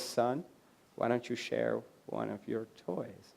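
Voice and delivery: quiet, firm fatherly request; kind but stern father. Retold or imitated. Father gently but firmly telling his son to share, softly. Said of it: "Mufasa is kind, but also stern".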